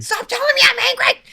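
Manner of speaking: hammed-up high, fast yell; exasperated protest. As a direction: shriek it like a mock tantrum. Acts arguing with the Lord.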